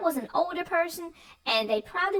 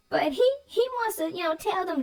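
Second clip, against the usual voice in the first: normal talking voice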